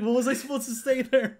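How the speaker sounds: very high, giggly "what was I supposed to say"; laughing embarrassment. Retold or imitated. Nagahama embarrassed after her flop, giggling high. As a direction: laugh it off sheepishly. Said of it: "she's like".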